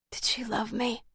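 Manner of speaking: breathy whispered question; barely voiced, hushed. A narrator's reading. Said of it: "Her voice was a bare whisper".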